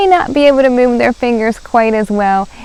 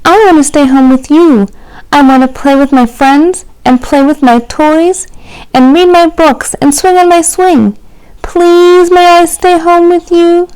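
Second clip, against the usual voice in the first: put-on a small child begging to stay home; pleading little raccoon; 7 semitones higher than usual. A narrator's reading.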